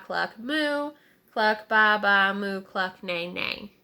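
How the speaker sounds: chanted barnyard noises; cluck, ba, moo and neigh in a playful chant; silly animal chorus; animal noises sung along. Acted. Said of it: "It sounded sort of like this".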